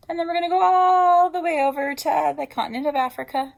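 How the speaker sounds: excited. Shown as a demonstration. Teacher thrilling the class over the map, excited. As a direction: get the kids thrilled about the trip.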